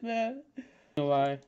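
usual speaking voice

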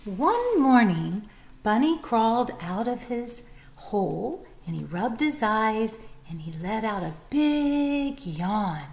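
put-on bright, lively read-aloud to children; storybook narration. A narrator's reading. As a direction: tell it like a picture book for little ones.

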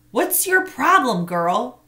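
put-on boy confronting a pest; demanding. A narrator's reading. Boy demands the ball from girl, loudly. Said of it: "he demanded".

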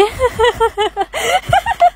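high, sustained laughter; helpless laughing fit. Acted. Worried friend catching the disease, high helpless laugh. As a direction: break into uncontrollable giggles.

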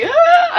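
a very high, loud shriek; mock scream. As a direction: squeal like an old man keeling over. Stan Lee passing out.

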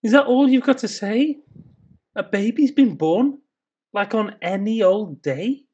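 can't believe that's all the news; rising, scoffing disbelief; incredulous outburst. Acted.

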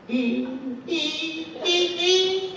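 high squeaky trumpet buzz; squeaky trumpet imitation. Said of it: "it was just like".

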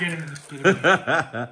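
slow, laughing mock heckle; mock bouncer laughing it out. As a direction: giggle through a pretend eject call.

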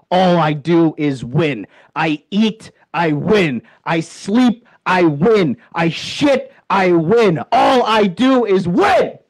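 excited boast. Acted. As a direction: pump it up with each line. Chanting winning boast.

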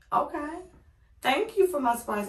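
put-on humouring a child, voice lifted high; sweet voice for a kid. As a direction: thank him the way you'd thank a proud toddler. Thanking the child for gift.

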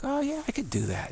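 high, breezy mock boast; hammed-up offhand brag; mock casual confidence. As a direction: play a viewer who thinks it's easy. Mock viewer sure of himself.

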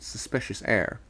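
ordinary delivery

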